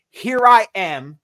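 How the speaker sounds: slow, loud proclamation; exclaimed. Acted. Answering the Lord's call in Isaiah, exclaimed. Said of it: "Exclamation bar".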